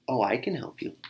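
plain reading voice

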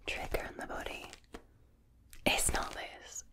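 ordinary talk

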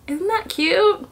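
gushing delight. Real, not acted. Gushing over swatch.

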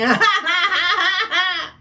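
a high real giggle at the visitor; amused giggle at the cat. Real, not acted. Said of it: "me hace reír".

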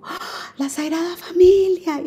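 light up as if spotting something wonderful; excited exclamation. Retold or imitated. She exclaims to the birth mother on seeing the Holy Family, delighted.

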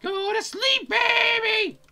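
very high mock shout, rising; high-pitched pretend yelling; hammed-up parental command. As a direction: yell a bedtime order, playfully overdone. Acts a parent to the doll.

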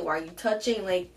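ordinary talk, nothing marked

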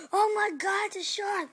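high, loud alarmed shout; shocked cry. Pretend. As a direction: shout in mock horror at the shark. Owner discovers pet is shark.

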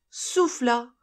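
ordinary delivery

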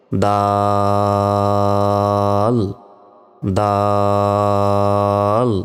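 দাল stretched out very slowly; long held vowel. Shown as a demonstration. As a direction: hold দাল for four seconds. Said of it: "চার second টেনে পড়তে হবে". (দাল চার সেকেন্ড টেনে)

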